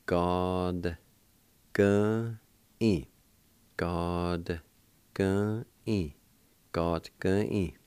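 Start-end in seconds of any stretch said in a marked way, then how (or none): 0.1-3.1 slow, drawn-out syllables with pauses; syllable-by-syllable demo. Shown as a demonstration. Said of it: "pronounce the D sound with the G A like this". 3.8-6.1 each syllable held long and set apart; syllable-by-syllable demo. Shown as a demonstration. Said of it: "like this".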